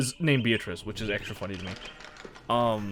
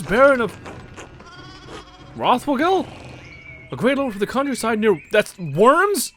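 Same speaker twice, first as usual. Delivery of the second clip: higher, louder pious priest; put-on priest voice; 8 semitones higher, 4 dB louder than usual. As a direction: preach at him like a pushy parish priest. Priest voice greeting the baron.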